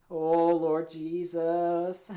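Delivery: slow, drawn-out groan of dread. Real, not acted. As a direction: say it like you're bracing for pain. Dread before eating hot sauce.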